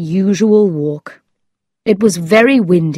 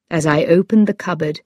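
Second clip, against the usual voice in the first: usual speaking voice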